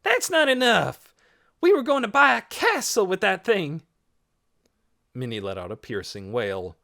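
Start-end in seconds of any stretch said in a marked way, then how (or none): 0.0-3.8 put-on high, loud, indignant girl; shouting out. A narrator's reading. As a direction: shout your outrage over the crowd. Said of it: "Kanza shouted out".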